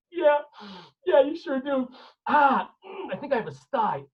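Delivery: imitating a man choking back crying; high, strained agreement, pretending it's just his eye; hiding his tears. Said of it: "and he's crying".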